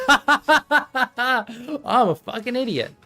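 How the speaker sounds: usual speaking voice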